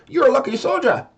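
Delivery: high Irish leprechaun voice. Pretend. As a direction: play a cheeky Irish leprechaun. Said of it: "like I'm a leprechaun voice".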